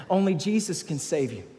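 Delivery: normal talking voice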